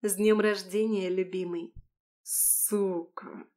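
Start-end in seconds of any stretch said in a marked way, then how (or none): 2.2-3.5 quiet hiss through gritted teeth. Acted. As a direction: spit it out under the breath. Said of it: "Очень тихо, шипя сквозь зубы".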